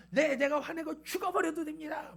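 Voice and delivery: high, loud acted tantrum; temper outburst. Jonah answers God's rebuke, losing his temper. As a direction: blow up in an imitated fit of temper. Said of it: "막 하면서 이제 성질을 냅니다".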